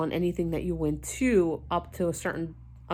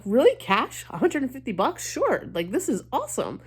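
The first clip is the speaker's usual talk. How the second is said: surprised excitement; 6 semitones higher than usual. Retold or imitated. Retelling her reaction to the company, squealing in surprise. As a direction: pitch jumping up on each short question. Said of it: "And I'm like".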